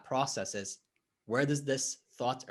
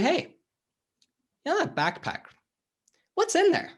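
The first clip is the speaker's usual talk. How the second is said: coaxing question pitched higher, a touch louder; acted coach prodding; 4 dB louder than usual. Coach asking the climbing client what's in the backpack, higher and coaxing. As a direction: play the curious coach leaning in.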